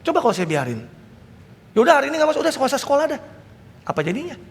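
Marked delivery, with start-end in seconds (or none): 1.7-3.2 high, easygoing let-off; giving-in parent. Retold or imitated. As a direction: pastor imitating a lax parent letting the kid skip school.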